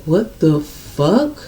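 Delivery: low, slow shocked disbelief; stunned slow what the fuck. Retold or imitated. Reading his texts, stunned and slow. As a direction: stretch it out in disbelief. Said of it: "I'm like".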